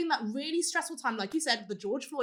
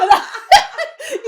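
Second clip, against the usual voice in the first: laughing; 12 semitones higher, 15 dB louder, faster than usual. Real, not acted. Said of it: "you just put on a voice".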